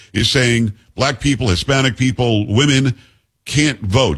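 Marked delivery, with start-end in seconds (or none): none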